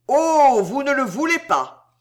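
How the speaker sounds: high, loud mocking disbelief; jeering, high-pitched provocation; provoking taunt. A narrator's reading. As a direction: goad him with exaggerated surprise. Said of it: "d'un air provoquant".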